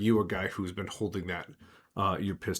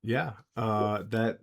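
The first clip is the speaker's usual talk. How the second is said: usual speaking voice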